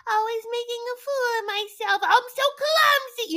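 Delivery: high put-on self-pitying voice; mock Debbie. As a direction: sigh it out like a dizzy lady. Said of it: "And she's like".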